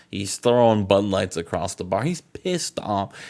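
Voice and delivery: ordinary talk, nothing marked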